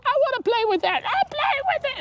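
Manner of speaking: very high, whiny mock-child voice; squabbling children. Parent mimics daughters fighting over a toy, squabbling. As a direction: imitate two kids fighting over a toy. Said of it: "they just wanna squabble and fight".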